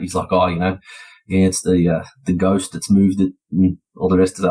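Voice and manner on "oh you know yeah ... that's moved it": ordinary delivery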